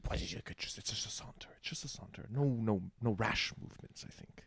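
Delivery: hushed, breathless excuse; whispering. Acted. A sneaky rogue whispers that he's only sauntering. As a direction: whisper it so the guards can't hear.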